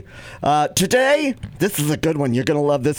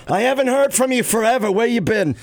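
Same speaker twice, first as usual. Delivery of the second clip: mom voice; 7 semitones higher than usual. Pretend. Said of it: "when I call her".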